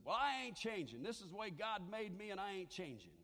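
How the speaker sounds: mock defiant lady; defiant retort. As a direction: plant your feet and refuse to budge. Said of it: "came to me and said".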